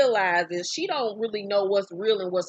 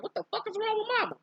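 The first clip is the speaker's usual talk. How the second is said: put-on much higher, fast child voice; mock kid voice; 12 semitones higher, 6 dB quieter, faster than usual. The kids watch their crying mother, a high fast kid voice. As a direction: squeak it like a confused kid.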